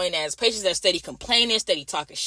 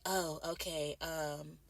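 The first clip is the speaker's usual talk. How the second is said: much softer and lower, sheepish; meek patient mumble; 5 semitones lower, 18 dB quieter, much slower than usual. Retold or imitated. Patient realises he pressed the call light, meek mumble. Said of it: "he was like".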